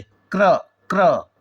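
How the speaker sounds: क्र with a quick half क gliding into र; demo pronunciation. Shown as a demonstration. Teacher showing students how to say क्र, half क said fast. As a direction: clip the क and roll straight into र in क्र. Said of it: "आधा क को जल्दी से बोलेंगे क्र".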